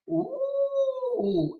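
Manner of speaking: high squealing ooh; a gleeful swoop up; delighted ooh. Real, not acted. Hearing the divorce docs plan, gleeful high ooh.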